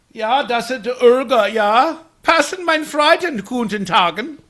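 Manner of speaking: high, loud, slow fake German; mock German. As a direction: babble in cartoon German. Plays a German speaker.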